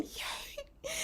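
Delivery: tiny, high-pitched hushed yay; little cheer. Real, not acted. Glad a friend is coming, little soft cheer.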